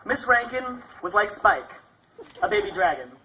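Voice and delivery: ordinary talk, nothing marked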